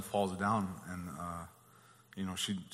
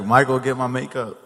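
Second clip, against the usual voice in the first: loud, higher-pitched call; retold demand; 4 semitones higher, 12 dB louder than usual. Son imitating his mother calling for her makeup, louder. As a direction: call it out louder, lightly comic. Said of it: "And then she's like".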